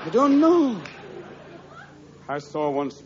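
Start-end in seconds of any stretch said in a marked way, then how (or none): 0.1-0.8 lightly voiced high comic character; raised pitch, falling away on KNOW; a hint of a high put-on voice. Answer in same act.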